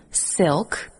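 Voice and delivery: ordinary delivery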